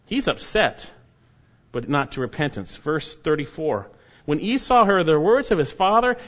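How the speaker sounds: ordinary talk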